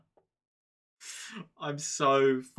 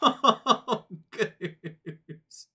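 helpless laughter; 9 semitones higher, 5 dB louder, slower than usual. Real, not acted. Reacting to huge gift.

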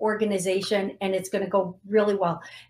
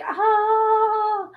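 high, loud emotional "Ah"; emotional high sigh; 5 dB louder than usual. Real, not acted. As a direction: let out a big overwhelmed "ah". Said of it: "it's making me a little emotional".

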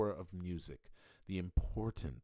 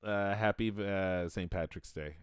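put-on Irish accent; 9 dB louder than usual. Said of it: "I can only do that Irish accent".

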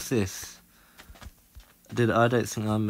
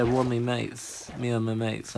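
normal talking voice

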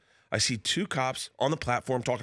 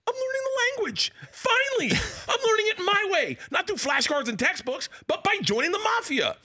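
much higher, excited inner monologue; high giddy delight at learning Russian. Retold or imitated. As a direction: squeak it like a thrilled kid. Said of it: "For a second, I'm like".

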